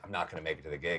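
soft, low, defeated line; despairing mutter. Retold or imitated. Comic telling Gary he won't make the gig, soft and low. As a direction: say it to yourself, giving up. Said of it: "I'm like".

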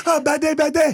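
high-pitched mock Chinese accent; Jackie Chan. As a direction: yelp it in a hammed-up Chinese accent. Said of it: "playing young Jackie Chan or something".

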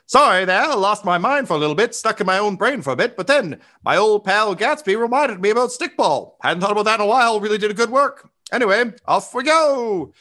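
very high, quick chatty character; Stonebender. Acted. Stonebender apologizing for losing her mind, high chatty voice. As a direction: chatter high, stretching the final send-off. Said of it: "and she's like".

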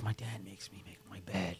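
put-on girl whispering her bed complaint; whispered complaint. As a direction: confide it under your breath. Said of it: "she's like".

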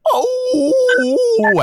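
high wailing "oh" of pain; a cry pitched far above his own voice; imitated howl of pain. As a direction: howl like a hurt umpire from outside. Injured umpire's howl heard outside.

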